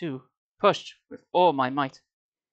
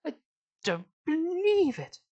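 very high, stretched exasperated catchphrase; Victor Meldrew; 13 semitones higher, slower than usual. Acted. Reader turns Sari into a sitcom grump, comic impression. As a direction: draw out "believe" in a high, comic wail. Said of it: "She turned to Victor Meldrew".

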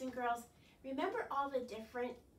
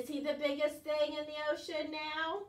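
ordinary delivery, nothing marked

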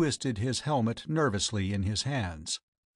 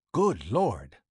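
startled exclamation; 2 semitones lower than usual. A narrator's reading. Doctor suddenly sees the body.

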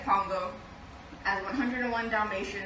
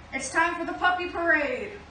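cheery announcement; 8 semitones higher, 5 dB louder than usual. Acted. Pongo announces parade.

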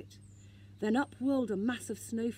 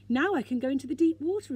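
quick, higher and louder; excited thought; 6 semitones higher, 8 dB louder, faster than usual. A narrator's reading. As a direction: rush the words out in delight. Sniff thrilled with his life belt.

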